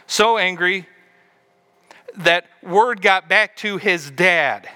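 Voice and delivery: slow, heavy emphasis on the anger; emphatic. Real, not acted. Preacher stressing how angry the son got, slow. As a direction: lean hard on every word.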